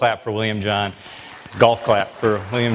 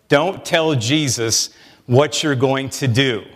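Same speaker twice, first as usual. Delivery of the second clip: slow, emphatic; slower than usual. Real, not acted. Preacher driving home the lesson to the congregation, slow and weighty. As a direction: say Don't tell Jesus slowly, each word weighted. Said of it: "Here's a lesson we learn".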